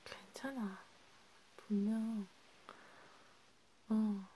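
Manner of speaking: hushed, half-whispered comfort to a crying friend. Acted. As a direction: soothe them gently, close to the mic.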